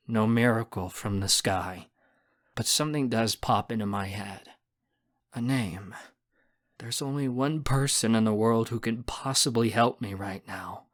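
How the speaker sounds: plain reading voice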